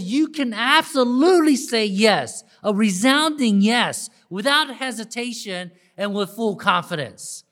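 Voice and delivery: loud, high preacher's assurance; emphatic preaching. Real, not acted. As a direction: preach it out with full confidence.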